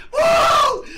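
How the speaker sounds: very high, long "woo"; piercing celebratory holler; ecstatic whoop. Real, not acted. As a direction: let out a victory whoop. Celebrating a huge gambling win.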